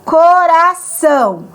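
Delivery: coração and injusto stretched out slowly, syllable by syllable; slow teacher's example read; slow syllable demo. Shown as a demonstration. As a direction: draw each syllable out for students.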